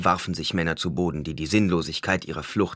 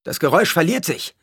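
high-pitched, louder bystander; someone in the crowd; 11 semitones higher, 6 dB louder than usual. A narrator's reading. One man lying in the street to the others, high raised voice. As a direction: call out what you hear. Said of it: "sagte einer".

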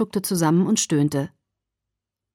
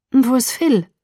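higher, hasty question; quickly asked; 5 semitones higher, 4 dB louder than usual. A narrator's reading. Daughter changing the subject with mother, quick question. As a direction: jump in quickly to divert her. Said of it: "fragte ich schnell".

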